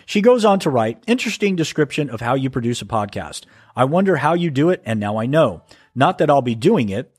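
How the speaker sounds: normal talking voice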